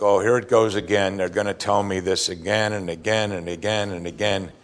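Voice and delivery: low, soft, resigned grumble; mildly fed up, droning on under the breath; weary child. Acted. Child hearing a parent repeat it over again, weary grumble. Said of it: "think back to a parent".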